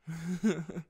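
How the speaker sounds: brief warm chuckle; short chuckle. Acted. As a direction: chuckle at your own joke. Light joke about existence.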